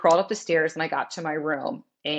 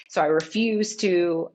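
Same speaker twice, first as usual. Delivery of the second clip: ordinary talk, nothing marked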